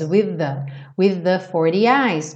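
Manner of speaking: With the said as one held TH, wið-ə; merged TH. Shown as a demonstration. Teacher showing students merged TH sounds, joined. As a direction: join the two th into one. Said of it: "Eu tenho dois th ... eu junto eles".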